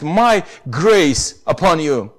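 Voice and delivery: ordinary talk, nothing marked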